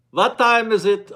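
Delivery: slow, mock German accent; a hammed-up German accent by mistake. Host trying a Swedish accent for viewer Kiki, landing on mock German accent. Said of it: "that was kind of German accent there".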